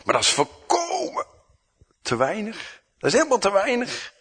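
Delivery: high, whiny disciples protesting; mocking a helpless excuse; mock complaint. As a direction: squeak out the complaint.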